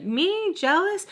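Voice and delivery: high, offended questioning; mock incredulity. Wife accused of being jealous, high incredulous imitation. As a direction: pitch it up in disbelief.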